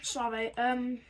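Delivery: talking while eating; apologizing through a bite of food; mouth full. Real, not acted. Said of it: "I'm eating, that's why".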